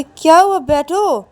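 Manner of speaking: high, loud bewildered question; can't believe she shut the door; surprised pleading. Acted. Ananya asks Payal, bewildered, why she shut the car door. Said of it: "तो तभी अनन्या बोलती कि".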